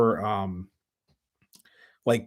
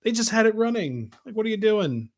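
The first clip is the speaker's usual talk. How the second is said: much higher, incredulous and pleading; exasperated disbelief; 8 semitones higher than usual. Retold or imitated. Host mocking the museum for retiring a running engine, high incredulous disbelief. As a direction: throw up your hands at the decision. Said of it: "It's like".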